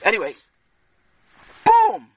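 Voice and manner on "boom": sudden high, loud "boom". Acted. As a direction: slam the word out like an explosion. Dramatic story beat.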